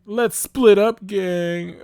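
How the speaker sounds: very loud, high cartoon announcement; big, high-pitched rallying cry; rallying catchphrase. A narrator's reading. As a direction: belt it out like a cartoon gang leader. Fred announces his catchphrase.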